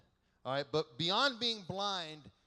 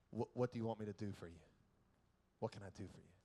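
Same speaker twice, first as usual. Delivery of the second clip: gentle; 7 semitones lower, 7 dB quieter, much faster than usual. Acted. Jesus crouching, speaks to beggar.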